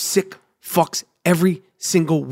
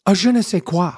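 French accent. Shown as a demonstration. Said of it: "I've been working on my French accent".

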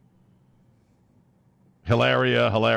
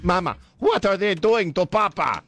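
high-pitched child with mock Spanish accent; hammed-up Spanish accent for a child; fake Spanish accent; 8 semitones higher than usual. Host mimics her child asking Mama, fake Spanish accent. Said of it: "stop with the fake accent".

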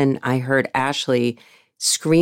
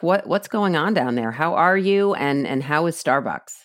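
normal talking voice

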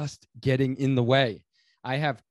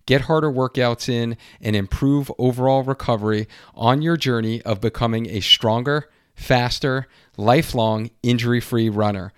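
ad read; 8 dB louder than usual. Acted. Host reading sponsor ad.

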